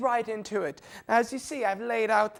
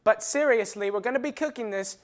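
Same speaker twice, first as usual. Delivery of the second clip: ordinary talk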